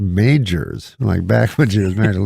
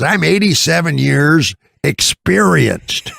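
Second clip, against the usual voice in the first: slow, raised old-man impression; Mickey Rooney; 6 semitones higher, slower than usual. Old star boasting of experience, imitated slow, high declaiming. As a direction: imitate an old showman proclaiming, drawn out. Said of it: "so he talks super loud".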